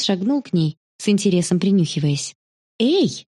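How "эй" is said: short, higher-pitched "hey"; quick interjection to stop someone; calling out. A narrator's reading. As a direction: call the dog off. Sister calls off the dog.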